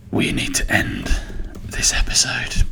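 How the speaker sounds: deep then whispered ominous tone. Pretend. Jokingly warning listeners the episode must end, ominous. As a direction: sound like a horror trailer.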